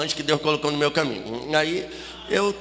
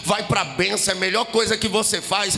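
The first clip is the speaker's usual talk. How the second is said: rising, driving testimony over music; raised-pitch revival testimony, building in intensity; impassioned preaching; 4 semitones higher than usual. Real, not acted. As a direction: preach it with mounting fervour.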